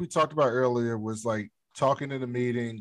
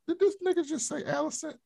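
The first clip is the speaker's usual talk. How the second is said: much higher, shocked mock outrage; shocked disbelief; 11 semitones higher, faster than usual. Best friend hearing her rival's name, stunned disbelief. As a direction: act stunned at the betrayal. Rhaenyra's shocked reaction voiced.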